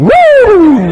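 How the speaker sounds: high, loud whoop; joyful whoop. Retold or imitated. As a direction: whoop it out like a man skipping to his car. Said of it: "he goes skipping".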